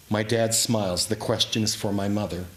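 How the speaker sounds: ordinary delivery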